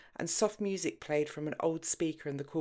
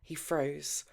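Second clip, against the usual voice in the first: plain reading voice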